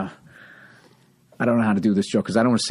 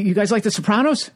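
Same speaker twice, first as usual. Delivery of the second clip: eager comic; 9 semitones higher than usual. Retold or imitated. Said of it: "He goes".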